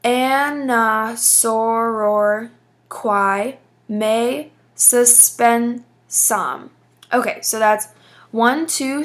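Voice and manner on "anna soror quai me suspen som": "Anna, soror, quai, me, suspen, som" drawn out evenly; "Anna, soror, quai, me, suspen, som" in deliberate, separated beats; syllable by syllable. Shown as a demonstration. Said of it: "go ahead and count our syllables".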